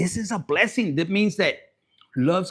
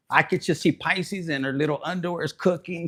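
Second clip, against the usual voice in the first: ordinary talk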